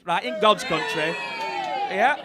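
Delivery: put-on broad Yorkshire accent; Huddersfield accent. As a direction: imitate a Huddersfield dad. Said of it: "He grew up in Huddersfield".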